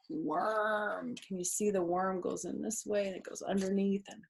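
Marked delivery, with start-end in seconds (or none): none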